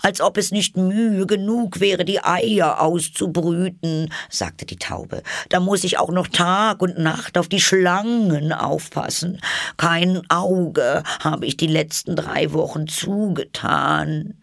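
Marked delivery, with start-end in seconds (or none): 0.0-4.1 high, put-upon bird voice; complaining pigeon. A narrator's reading. Pigeon moans about hatching eggs, exasperated. 5.5-14.4 high, put-upon bird voice; complaining pigeon. A narrator's reading. Pigeon moans about hatching eggs, exasperated.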